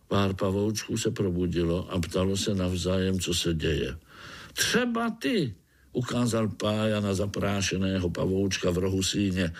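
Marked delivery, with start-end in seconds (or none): 4.5-5.5 high-pitched call to one listener; single him out in a high, drawn-out voice; eager little spider. A narrator's reading. Pája addresses one spider. (vysoký hlásek pavoučka)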